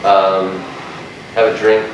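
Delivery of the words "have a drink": normal talking voice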